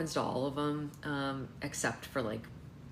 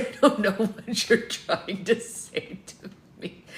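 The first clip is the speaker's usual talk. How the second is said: laughing; 5 semitones higher, 8 dB louder, slower than usual. Retold or imitated. Laughing retelling confused text.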